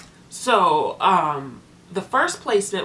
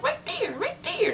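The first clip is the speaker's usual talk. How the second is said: put-on high, slow little-kid voice; toddler voice; 5 dB quieter than usual. Imitating the foster boy Gabe answering his foster mom, high and slow like a toddler. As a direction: imitate a toddler pointing her out. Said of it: "And he'd be like".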